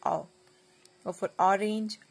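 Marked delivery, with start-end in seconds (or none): 0.1-0.2 "ओ" said as the short phonics sound of O; O letter sound. Shown as a demonstration. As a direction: sound out "ओ" as at the start of orange. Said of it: "O का sound क्या होता है".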